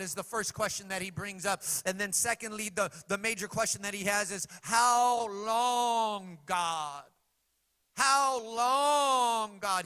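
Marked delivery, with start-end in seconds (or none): 4.6-9.8 loud, high pleading cry. Retold or imitated. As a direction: call out in despair. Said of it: "He cries these two questions out".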